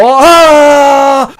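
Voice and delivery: pain scream. Acted.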